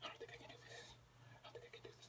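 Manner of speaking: imitating a panicked whisper; scared whisper. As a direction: whisper as if losing nerve. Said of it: "Drita starts shaking".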